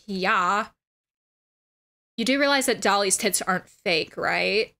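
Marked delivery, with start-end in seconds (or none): none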